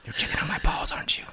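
hushed mock whisper. Voicing the roommate caught in the bathroom, whispered. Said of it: "He's like".

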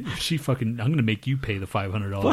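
ordinary talk, nothing marked

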